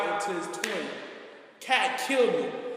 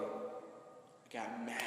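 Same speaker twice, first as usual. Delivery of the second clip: whispered anger; 9 semitones lower, 18 dB quieter, much slower than usual. Real, not acted. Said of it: "I'm talking about mad, furious".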